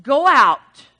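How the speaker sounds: loud, drawn-out command; loud commanding voice of God; God speaking. Acted. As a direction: boom out a divine order. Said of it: "God speaking".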